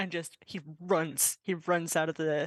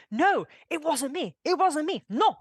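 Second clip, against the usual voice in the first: high, loud protest; accused staff member; 8 semitones higher, 5 dB louder than usual. Acted. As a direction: protest your innocence to the accusing butler. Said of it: "and they say".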